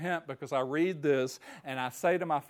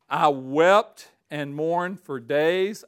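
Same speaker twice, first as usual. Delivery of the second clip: slow, louder, weighty reading; solemn scripture reading; 7 dB louder, much slower than usual. Acted. Preacher reading Nehemiah's lament, slow and solemn. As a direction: read each word like it weighs a ton.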